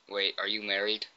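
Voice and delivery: usual speaking voice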